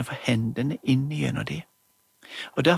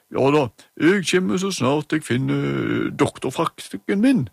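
louder, gruff hallo; gruff doctor voice; 5 dB louder than usual. Acted. Emma as doctor answers phone, gruffly. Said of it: "hun gjorde seg veldig grov i målet".

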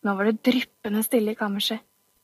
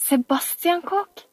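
excited reveal; 7 semitones higher, slower than usual. Acted. Camilla reveals the burglar.

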